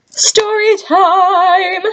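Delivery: high, slow, drawn-out story time; sing-song announcement. Real, not acted. Vlogger announcing a new story, high and sing-song.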